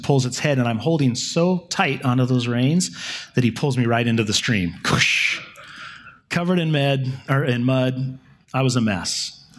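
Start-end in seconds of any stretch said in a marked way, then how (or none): 4.8-5.4 voiceless whooshing "cush"; a quick hushed splash sound; splash noise. Retold or imitated. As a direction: imitate a body splashing into water. Imitates splash into the stream.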